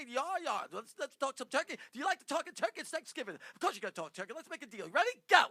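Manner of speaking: brash, booming fast-talking agent; loud showbiz agent. Pretend. Prank caller booming at Kevin as a blustering agent. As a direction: bark it like a pushy Hollywood agent. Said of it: "Do it, do it as Brian".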